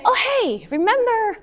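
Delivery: high, friendly "oh, hey"; light opener. Retold or imitated. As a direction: open gently and brightly. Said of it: "And we say".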